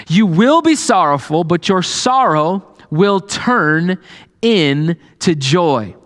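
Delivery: fervent preacher reading the promise aloud; preaching scripture reading. Acted. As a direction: proclaim each line of the verse. Rereads verse with preaching force.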